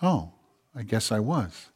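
low, very soft, halting concession; acted small, chastened reply; sheepish admission. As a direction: concede it quietly, after a pause. Humbled reply to God's correction.

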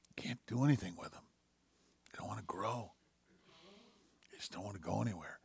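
low, very soft, grieving retelling; hushed, heavy-hearted quote; retold tearful pastor. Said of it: "he started to cry".

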